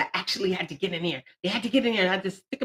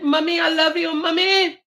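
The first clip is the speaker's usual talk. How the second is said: calling mommy; 7 semitones higher, 4 dB louder than usual. Real, not acted. Calling out to second mom.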